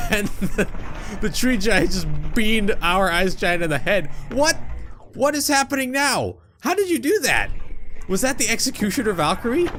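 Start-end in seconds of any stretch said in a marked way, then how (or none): 4.3-9.7 surprised. Real, not acted. Stunned at the battle.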